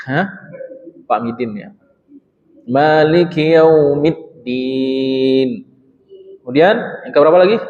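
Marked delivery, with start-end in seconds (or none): 2.6-5.7 Maliki Yaumiddin very slowly, the double d pressed; doubled d pressed. Shown as a demonstration. Teacher warns against saying Midin, reads it slowly. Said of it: "nggak ada baca Midin".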